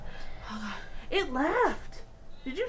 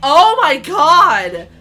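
loud, drawn-out cry of disbelief; gasp, then cry it out slowly; shocked outcry; 2 semitones higher, 11 dB louder, much slower than usual. Real, not acted. Viewer watching ghost footage, shocked outcry.

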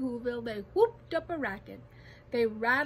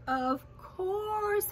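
higher, drawn-out willing reply; earnest assurance; 8 semitones higher than usual. A narrator's reading. Elephant promising the tiny people his help, earnest assurance. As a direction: promise it wholeheartedly.

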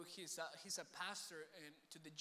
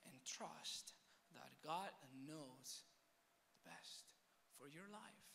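soft, slow preaching with long pauses; measured, intimate sermon close; hushed exhortation; 5 dB quieter than usual. Acted. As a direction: lay down each phrase quietly and solemnly.

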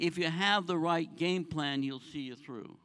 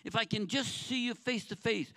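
higher, louder anguished plea to God; crying out; 6 semitones higher, 3 dB louder than usual. Retold or imitated. Pastor retelling his own plea to God, raised anguished voice. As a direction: cry out for God to show up. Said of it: "I was crying out".

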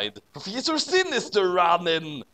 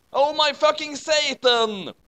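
higher shout of alarm; shocked shout; 11 semitones higher, 3 dB louder than usual. A narrator's reading. Harry seeing the Dark Mark, higher alarmed shout. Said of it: "Harry shouted".

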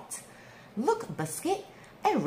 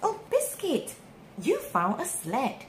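usual speaking voice

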